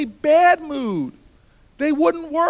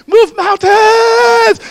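high, drawn-out preacher shout; mock church crowd yelling; 10 semitones higher, 16 dB louder, much slower than usual. Newark crowd voiced screaming.

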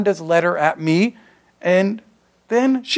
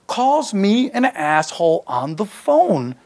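ordinary delivery

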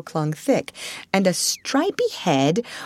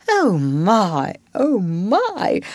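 excited gasping; 3 semitones higher, much slower than usual. A narrator's reading. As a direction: gasp it out in mild amazement. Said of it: "still gasping".

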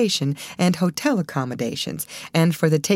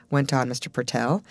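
plain reading voice

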